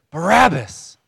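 high, loud shout of a name; crowd shout. Retold or imitated. Preacher voicing what the prisoner hears from the crowd, shouting. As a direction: shout it as the overheard crowd would. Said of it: "All he hears is".

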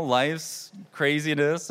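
usual speaking voice

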